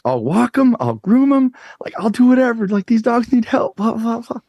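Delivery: put-on a kid on the edge of tears, begging to help; emotional. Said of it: "I'm just so emotional".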